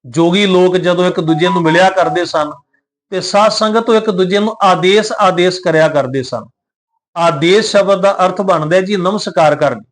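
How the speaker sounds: ordinary delivery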